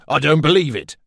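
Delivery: a man who can't credit what he sees; exclaiming in disbelief. A narrator's reading. Redbeard, seeing the bee threat, exclaims in disbelief. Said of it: "even as he exclaimed".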